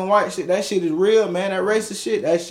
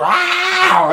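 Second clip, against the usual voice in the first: high, drawn-out attack roar; comic animal snarl, let rip; imitated dog roar; 10 semitones higher than usual.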